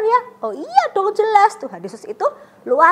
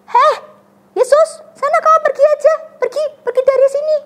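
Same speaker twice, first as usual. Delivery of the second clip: much higher, louder furious shout; angry crowd; 13 semitones higher, 9 dB louder than usual. Retold or imitated. Townspeople shout at Jesus to leave, furious. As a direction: drive him out of town. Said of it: "pada bilang ke Tuhan Yesus".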